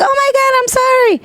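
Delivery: high, alarmed apology; imitating Jairo. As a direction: blurt the apology in a panic. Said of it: "he was like".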